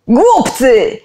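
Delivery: furious shout at the crowd; yell. A narrator's reading. Soldier screaming at the villagers, loud and furious. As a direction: bark it out full force. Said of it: "wrzasnął".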